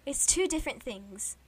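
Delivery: put-on British accent. Shown as a demonstration. Proving to a friend it differs, British accent. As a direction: insist in an amateur British accent. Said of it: "this is my British accent".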